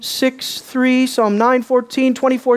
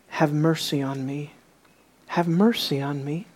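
low, hushed entreaty; quiet, lowered beggar's appeal; soft plea; 7 semitones lower, 7 dB quieter than usual. Acted. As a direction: plead gently, well under your usual voice. Voices the beggar's plea softly.

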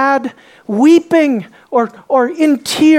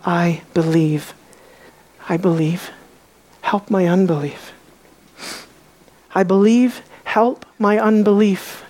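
tearful plea; 5 semitones lower, 4 dB quieter than usual. Retold or imitated. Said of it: "He cried out and said".

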